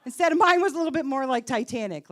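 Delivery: usual speaking voice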